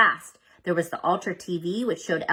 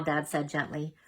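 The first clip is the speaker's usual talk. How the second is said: plain reading voice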